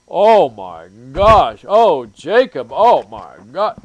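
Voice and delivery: loud, slow, drawn-out gasp of shock; mock horror. Mocking statists aghast at a libertarian, loud slow horror. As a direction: ham up the horrified pearl-clutching.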